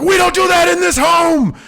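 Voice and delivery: high, loud, furious dad yell; angry shout. Retold or imitated. Dad scaring his kids after hitting the table, shouting. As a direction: bellow it at the kids in a rage. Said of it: "I was like".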